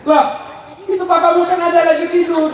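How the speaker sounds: high, quick neighbour's protest; puzzled neighbour. Acted. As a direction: play a neighbour contradicting the child. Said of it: "kata tetangga".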